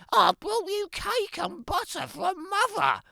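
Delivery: put-on wolf faking a little girl's voice; a put-on squeak to fool granny; squeaky fake girl. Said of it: "I squeaked".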